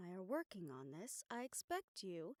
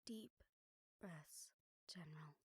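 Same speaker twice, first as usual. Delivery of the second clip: much softer, near whisper; low tender murmur; soothing hush; 5 semitones lower, 9 dB quieter than usual. Acted. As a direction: ease him into calm breathing. Talking the general down from panic.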